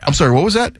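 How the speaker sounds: usual speaking voice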